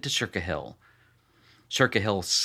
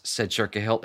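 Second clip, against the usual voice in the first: plain reading voice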